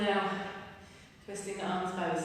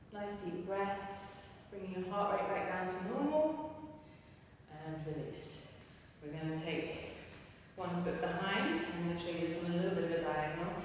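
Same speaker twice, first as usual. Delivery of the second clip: softer, calm stretching cues; soft cool-down guidance; 4 dB quieter than usual. Acted. Instructor guiding her class through the cool-down, softly. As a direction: ease the class down gently.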